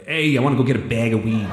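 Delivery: mock Chicago local accent. Shown as a demonstration. Showing a co-host how Chicago talks, mock Chicago accent. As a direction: put on a Chicago accent for a joke. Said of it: "Chicago's more like".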